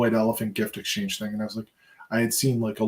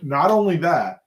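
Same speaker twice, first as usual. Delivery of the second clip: infomercial pitch; 6 semitones higher, 6 dB louder than usual. Acted.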